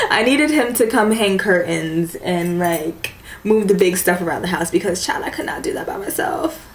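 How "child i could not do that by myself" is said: hushed confiding whisper; whispered aside to the viewers. Real, not acted. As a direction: let it out as a secret aside.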